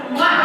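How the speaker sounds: loud smacking kiss; exaggerated mwah; kiss sound. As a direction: plant a noisy little kiss. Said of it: "give you a little kiss".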